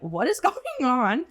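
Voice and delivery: mock-baffled, breaking into a laugh; amused disbelief. Cook reacting to the chef's mid-service stretches, amused disbelief.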